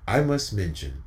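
usual speaking voice

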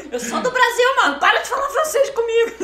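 loud, high, laughing outburst; mock exasperated protest. Protesting to customers who speak French to her, exasperated. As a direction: burst out in comic indignation.

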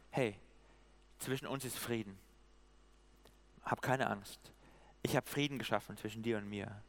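soft, low, with long pauses; calm a frightened person tenderly; gentle reassurance. Acted. Preacher voices God granting peace to Gideon, gently reassuring.

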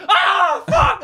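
very high, loud terrified yell; genuine-fear scream. Shown as a demonstration. Showing how real fear would sound, loud high scream. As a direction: scream it out in sudden panic. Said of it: "Not like".

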